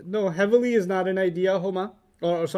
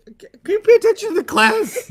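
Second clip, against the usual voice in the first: much higher, louder mock scold; mock stern teacher; 8 dB louder than usual. Teacher pretends to scold students for not listening. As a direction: play the exasperated teacher.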